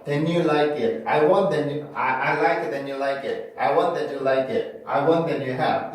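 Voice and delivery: "that you" said as "then you"; wrong n-linking. Shown as a demonstration. Teacher showing the mistake to avoid, T turned into n. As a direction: turn the T of "that" into an n before "you". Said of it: "요거는 니은으로 바꾸면 안 돼요".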